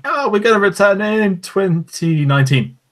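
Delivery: high, slow, awkward announcement; awkward host voice. Retold or imitated. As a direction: squeak it out awkwardly then flee. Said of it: "how awkward he was saying".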